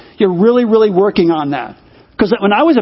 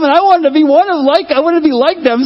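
normal talking voice

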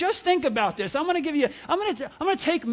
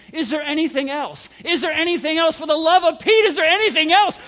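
impatient cashier building; 3 semitones higher, 5 dB louder than usual. Retold or imitated. Cashier pressing an indecisive customer, rising to loud. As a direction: keep asking, more fed up each time. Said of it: "the person's saying".

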